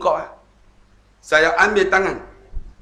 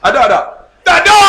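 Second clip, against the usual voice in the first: much higher and louder, teasing; playful shout; 11 semitones higher, 10 dB louder, much faster than usual. Real, not acted. Preacher teasing congregation about the feast, loud playful shout. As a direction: shout it high and loud at the crowd.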